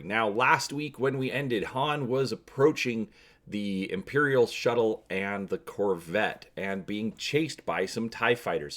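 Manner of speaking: ordinary delivery, nothing marked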